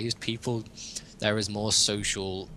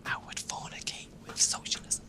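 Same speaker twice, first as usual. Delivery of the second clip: hushed, breathy naughty confession; whispered joke; 6 dB quieter than usual. Real, not acted. Podcaster whispering a naughty joke about socialism to co-hosts. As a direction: whisper it as a cheeky secret.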